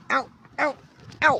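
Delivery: high yelps of pain; real pained ow. Real, not acted. Owner scratched while checking the cat, high real yelps. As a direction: yelp as the claws catch you.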